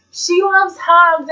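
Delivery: slow, high teasing sing-song; teasing friends. Retold or imitated. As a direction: tease her in front of the idols. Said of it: "everyone behind me just like".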